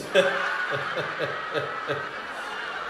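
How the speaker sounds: soft airy wheezing laugh; breathless laugh. Real, not acted. Teller laughs breathlessly at the clerk's answer. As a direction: crack up silently at the memory. Teller cracks up at own story.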